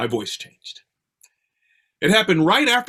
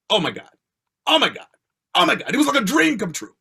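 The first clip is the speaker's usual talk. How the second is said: building excitement; 6 semitones higher, faster than usual. Retold or imitated. Retold joy at getting role back.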